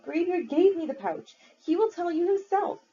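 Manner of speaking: put-on high, pleading boy voice; desperate boy.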